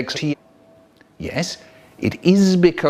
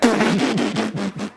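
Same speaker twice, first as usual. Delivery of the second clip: imitated snorting laugh, higher; 5 semitones higher than usual. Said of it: "Haribo was still snorting away like mad".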